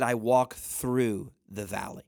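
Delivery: slow, walk leaned on firmly; stress on walk. Shown as a demonstration. Preacher to listeners, slow stress on walk. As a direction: drag out I walk through the valley. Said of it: "Notice that he kept walking through".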